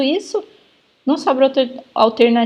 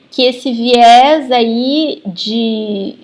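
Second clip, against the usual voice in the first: drawn-out mock quote, louder; imitando alguém; 5 dB louder, much slower than usual. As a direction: stretch the words out loudly, imitating someone. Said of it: "eu tô imitando a pessoa falando". (imitando alguém, arrastado e mais alto)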